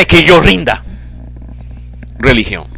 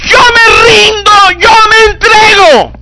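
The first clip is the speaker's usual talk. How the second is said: desperate shout; 4 dB louder than usual. Acted. Boy in a sinking boat cries to God, shouting desperately. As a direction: cry out like a boy sinking in the sea. Religion's plea voiced.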